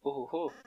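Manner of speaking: high cackling villain laugh; evil chuckle. Acted. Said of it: "C'est mon rire, euh, machiavélique".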